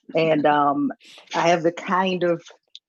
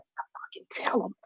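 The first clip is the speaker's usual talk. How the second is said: much softer, whispered confirmation; mock whisper; 3 semitones higher, 9 dB quieter than usual. Towel thief confirming to the comic, mock whisper. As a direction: whisper it back with conviction.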